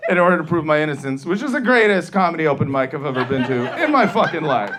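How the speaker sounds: ordinary talk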